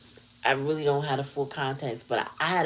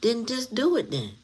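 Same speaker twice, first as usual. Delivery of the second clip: higher, fed-up imagined comeback; imagined exasperated reply, pitched higher; exasperated retort; 8 semitones higher than usual. Retold or imitated. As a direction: snap back at him, fed up. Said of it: "I would have been like".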